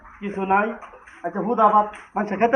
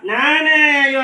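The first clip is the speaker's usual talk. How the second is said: pleading, raised-voice objection; loud protest; 6 semitones higher, 9 dB louder than usual. Acted. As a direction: cry out to stop the child. Protesting at the child's refusal.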